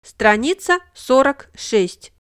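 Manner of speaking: ordinary talk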